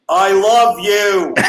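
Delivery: slow drawn-out yell of love; screaming. Retold or imitated. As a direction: holler it through a window. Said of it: "everyone screaming".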